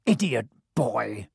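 slow, contemptuous snarl. A narrator's reading. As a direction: spit the insult at him. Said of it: "snarled Snape".

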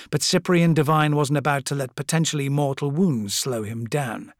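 usual speaking voice

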